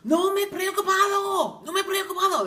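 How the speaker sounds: hammed-up son soothing his mother; imitating the guy on the phone. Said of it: "and says".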